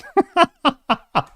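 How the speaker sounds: high, real burst of laughter at the headline. Real, not acted. Reading Cocaine Bear beating Ant-Man, real high laugh. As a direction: crack up at the absurd news.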